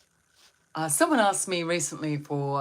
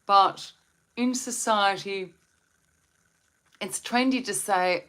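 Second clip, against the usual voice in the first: ordinary delivery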